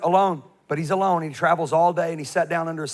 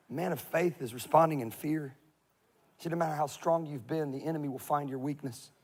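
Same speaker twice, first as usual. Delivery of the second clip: much softer, grave warning; quiet earnest; 2 semitones lower, 9 dB quieter than usual. Real, not acted. Preacher warning the congregation, soft and grave. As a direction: drop the voice to warn them.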